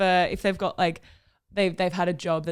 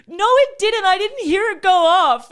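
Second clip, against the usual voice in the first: high, loud imitated grump; mock angry; 11 semitones higher, 10 dB louder than usual. Imitating the boyfriend insisting no alarm, loud mock anger. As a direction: act out his sleepy fury, much higher and louder. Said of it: "And you got so mad".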